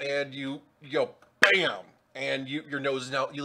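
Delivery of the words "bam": loud, sudden, higher-pitched "bam"; impact exclamation. Acted. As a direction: burst it out like a crash. Game master voices smash into wall.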